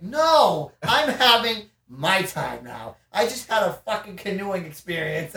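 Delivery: loud, high, worked-up retelling; indignant protest. Retold or imitated. Telling the friend off for disturbing the rest, indignant. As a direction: push back loudly, defending time to relax.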